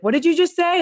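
imitating a puzzled parent; a parent's what-did-you-say; parent asking. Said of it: "they'll have their parents be like".

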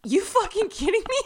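high-pitched incredulous protest; retold indignation. Reacting to having no seat assigned, indignant. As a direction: draw back, then pitch up in outrage.

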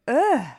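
loud, high "ugh" of revulsion; exasperated, grossed-out ugh; disgusted groan. Real, not acted. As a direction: groan out your disgust loudly. Disgusted at what he remembers.

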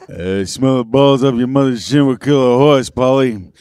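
very low mumbled tough guy; Sylvester Stallone. Pretend. Contestant takes a turn as movie star, low mumble. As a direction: drop it way down, mock action-star voice. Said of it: "Go ahead, Sylvester".

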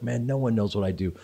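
quiet confiding. Retold or imitated. Actor retelling his friend's reply during a hug, quietly confiding. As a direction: say it softly, as a private admission.

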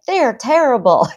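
high, loud mock indignation; overly protective parent. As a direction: leap hotly to the child's defence, hammed up. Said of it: "really getting overly, overly protective".

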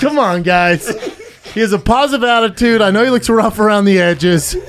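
loud, coaxing mock pitch; mock hawking. Comic urges imagined takers to pick friend, mock hawking. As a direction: push the sale at full voice, joking.

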